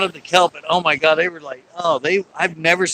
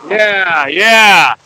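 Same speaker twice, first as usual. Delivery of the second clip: loud, drawn-out mock yell; big playful shout, falling away; yelling back; 4 semitones higher, 10 dB louder than usual. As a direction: holler back at noisy animals. Talking back at barking sea lions.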